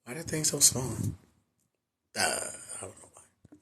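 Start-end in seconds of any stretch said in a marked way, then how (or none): 2.2-3.6 whisper. Real, not acted. Fumbling with the screen, to himself.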